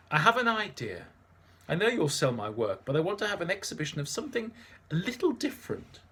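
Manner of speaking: lightly voiced eager artist; eager Antoine. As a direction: sell the idea with nervous eagerness. Antoine pitches his idea.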